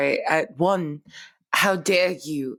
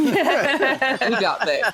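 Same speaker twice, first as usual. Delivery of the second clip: slow, laughing and higher; 8 semitones higher, much slower than usual. Real, not acted. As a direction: say it through a laugh. Laughing confidence.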